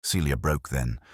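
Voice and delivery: usual speaking voice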